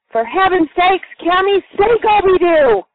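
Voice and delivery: a fired-up cry, high and loud; excited exclamation. Retold or imitated. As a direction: burst out with the family catchphrase. Mom exclaims after braking hard.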